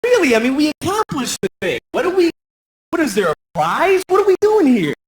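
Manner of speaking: high, incredulous whine; worn-out mock protest; exasperated protest. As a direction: complain in disbelief. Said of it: "And I was like".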